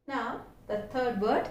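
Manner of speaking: ordinary delivery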